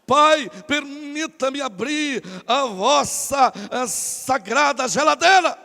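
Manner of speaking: very drawn-out mock-holy address; pompous son. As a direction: stretch it like an overdone prayer. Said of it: "Imagina ele entrando, dizendo". (súplica pomposa e arrastada, de gozação)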